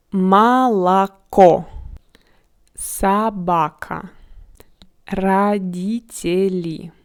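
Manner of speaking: Молоко, Собака, Родители slowly as "ma-la-KO, sa-BA-ka, ra-DI-te-li"; unstressed O as A. Shown as a demonstration. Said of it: "All O without an accent I pronounce like A".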